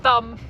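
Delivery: them copied as "thum"; echoed mispronunciation. Retold or imitated. Wife teasing back his odd word, echoed mispronunciation.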